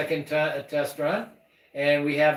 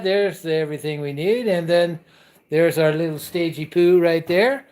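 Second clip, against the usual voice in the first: ordinary delivery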